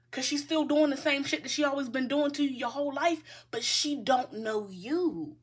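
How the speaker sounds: ordinary delivery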